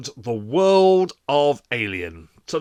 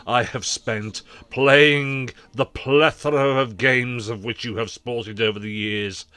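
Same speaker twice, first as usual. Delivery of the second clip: loud, slow, grand poetic recital; mock poetic ode; 3 semitones lower, 4 dB louder, slower than usual. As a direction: recite it like a love sonnet. Mock love poem to console.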